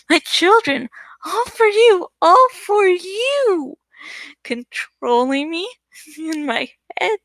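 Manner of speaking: a madwoman taunting, then breaking into tears; insane woman raving. Acted. Insane woman taunting the narrator about her husband and children, giggling then sobbing.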